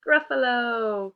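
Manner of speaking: Gruffalo said slow and loud, stretched with a falling tune; drawn-out title. Shown as a demonstration. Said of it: "It's The".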